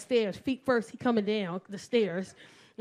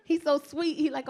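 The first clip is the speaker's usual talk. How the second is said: usual speaking voice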